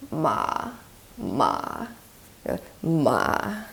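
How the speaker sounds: ma dipping low into creak, then rising a little; creaky dipping tone. Shown as a demonstration. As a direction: sink ma into creaky voice, then lift it slightly. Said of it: "you go into creaky voice and then kind of rise up".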